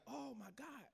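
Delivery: high shocked oh my God; shocked gasp. Retold or imitated. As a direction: react in disbelief. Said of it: "And I'm like".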